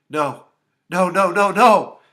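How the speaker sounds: horrified outburst; crying out. A narrator's reading. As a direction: escalate into a loud, distraught cry. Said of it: "Tara cried out".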